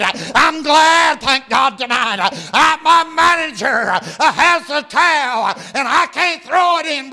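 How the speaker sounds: high, loud revival preaching, rising to shouts; fired-up pulpit preaching with long stretched words; preaching at full force. Acted. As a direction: preach it hard to the congregation.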